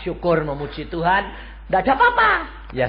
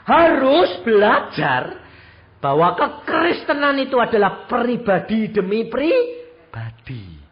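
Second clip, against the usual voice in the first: louder, higher preaching exhortation; 6 semitones higher, 7 dB louder than usual. Acted. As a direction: drive the lesson home to the congregation.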